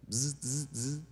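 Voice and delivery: short buzzing noises like a vibrating phone; phone vibration imitation. Speaker imitates phone vibrating against piano stand, short buzzes. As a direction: buzz like a phone on wood.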